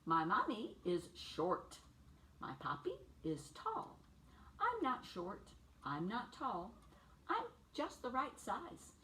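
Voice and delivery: lively picture-book read for little ones; bright storybook read-aloud for preschoolers; reading to children. A narrator's reading. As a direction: read it like story time on the rug.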